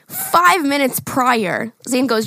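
ordinary delivery

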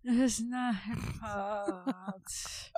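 slow, hammed-up crying whine; mock sobbing Bella. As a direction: drag it out like a sobbing fake cry. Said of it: "Bella goes".

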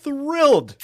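high, loud and slow thrilled; excited. Acted. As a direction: gush with excitement.

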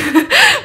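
high burst of laughter; laughing. Real, not acted. Speaker just kidding about a name, real laughter. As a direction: giggle at your own joke. Laughs after her joke.

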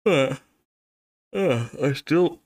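slow words cut by a hiccup; hiccuping between words. Real, not acted. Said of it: "these hiccups are not exactly going away".

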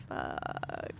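unvoiced dread, barely let out; hushed inward swear; whispered curse. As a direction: whisper it to yourself, retelling the moment. Retells silent panic to self.

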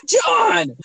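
high-pitched mock protest; Daniel. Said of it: "he got mad at you for getting mad at him".

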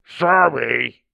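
low voice through a wall; muffled, as if behind fur. A narrator's reading. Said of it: "came a low, muffled voice beyond it".

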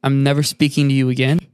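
retold dramatic quitting. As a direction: imitate someone grandly quitting, low and deliberate. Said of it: "this man's been like".

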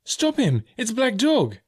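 put-on high, urgent boy's cry; excited call to stop a runaway; crying out in alarm. A narrator's reading. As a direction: raise the alarm, pitch way up. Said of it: "I cried".

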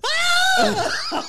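very high, loud shriek; hammed-up terrified kid scream; imitated child scream. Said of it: "and he fucking screamed".

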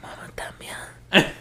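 soft unvoiced whisper. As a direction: breathe it out quietly. Echoing the whispered title.

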